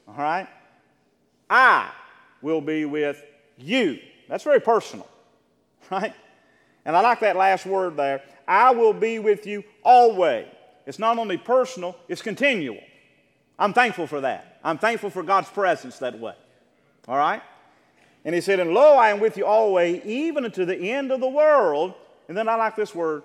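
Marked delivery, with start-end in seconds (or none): 1.5-4.0 "I" punched loud, then a pause before "will be with you"; emphatic promise. Retold or imitated. Stresses each word of God's promise. 8.4-10.5 loud, high and slow proclamation; emphatic promise. Retold or imitated. Preaches the quoted promise with force. 18.6-21.9 loud, raised-pitch Bible reading; Scripture proclamation. Acted. Reads Scripture aloud to congregation.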